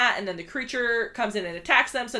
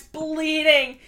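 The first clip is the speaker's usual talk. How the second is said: ordinary delivery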